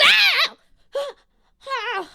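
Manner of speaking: very high, loud yelp of pain, then a gasp; startled yelp. Acted. Inventor startled at her turret, high pained yelp. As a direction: shriek an ow, catch your breath.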